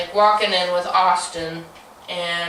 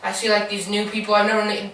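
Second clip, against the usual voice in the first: usual speaking voice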